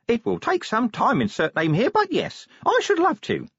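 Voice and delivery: imp voice. Acted. Imp replies.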